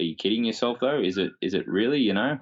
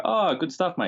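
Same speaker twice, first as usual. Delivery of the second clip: cheery townsfolk; 10 semitones higher than usual. Retold or imitated. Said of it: "And they go".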